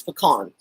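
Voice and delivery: "pecan" said puh-KAHN, stress on the end; pronounced puh-KAHN. Shown as a demonstration. Texan giving her own way, pecan as puh-KAHN. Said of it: "For me, it's".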